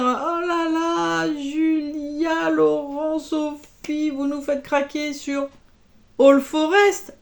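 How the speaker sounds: high, gushing mock fan voice, stretched at the start. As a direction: play the excited fans swooning over the patterns. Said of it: "Tous ceux qui sont en train de me dire".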